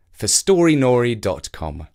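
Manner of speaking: ordinary delivery, nothing marked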